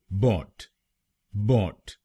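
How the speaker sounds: बॉट said alone with an open o and a hard t; second syllable alone. Shown as a demonstration. Teacher drilling the second syllable, बॉट said alone. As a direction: isolate बॉट as one short clipped syllable. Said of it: "दूसरे शब्दांश को यानी बीओटी हम बोलेंगे".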